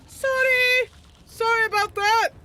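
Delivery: high, loud yelled apology; Beast yelling. Acted. Beast apologizes to hurt teammate, yelling high. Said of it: "Beast yells".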